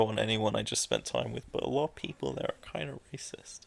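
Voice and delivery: hushed aside; whispering. Real, not acted. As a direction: drop to a whisper. Confiding a touchy remark.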